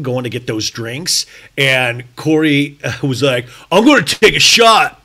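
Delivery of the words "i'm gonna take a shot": loud, higher mock line; wrong quote. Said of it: "No, sorry".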